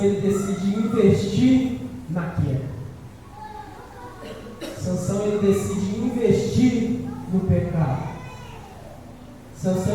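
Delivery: ordinary talk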